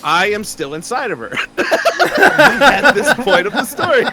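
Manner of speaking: high-pitched words through real laughter; can barely get it out for laughing; laughing while telling. Real, not acted. Laughing at his own story.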